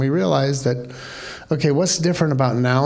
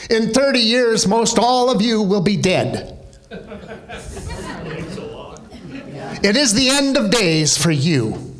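Billy Graham impression; 3 semitones higher than usual. Teacher imitating Billy Graham with a southern preacher accent. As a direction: intone it like a southern evangelist warning the crowd. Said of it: "I can't do his accent".

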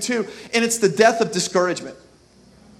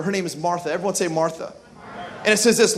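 ordinary talk, nothing marked

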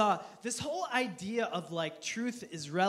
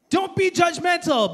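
high, loud admonishment; hammed-up moral rebuke; mock scolding; 9 semitones higher, 8 dB louder than usual. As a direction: scold the crowd loudly. Acts contradictory public voices.

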